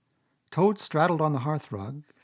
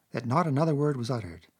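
plain reading voice

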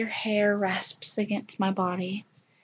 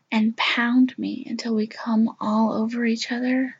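whispering. Acted. Intimate whisper.

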